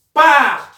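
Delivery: "Pa" punched loud and clear; syllable called out. Shown as a demonstration. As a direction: call out the syllable "Pa". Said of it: "separando as sílabas".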